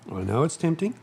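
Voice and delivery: usual speaking voice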